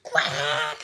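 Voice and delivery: loud outraged duck squawk; enraged quack. A narrator's reading. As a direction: cry out like a furious duck. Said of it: "flew into a rage".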